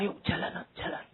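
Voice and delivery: hushed encouragement to walk; whispered coaxing. Retold or imitated. Mother whispering to her toddler to walk. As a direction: whisper it, urging the child on. Mother urging child softly.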